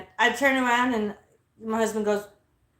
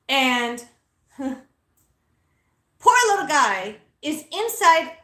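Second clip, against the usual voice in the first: usual speaking voice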